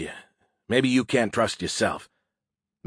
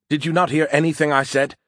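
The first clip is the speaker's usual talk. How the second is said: higher, mildly indignant challenge; raised-voice exasperation; exasperated; 5 semitones higher, 4 dB louder than usual. A narrator's reading. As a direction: demand to know if they listened. Acted quarrel, exasperated at being dismissed.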